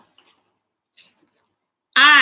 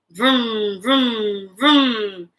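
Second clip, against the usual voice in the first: engine vroom; 5 semitones lower, much slower than usual. Shown as a demonstration. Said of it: "Start your engines".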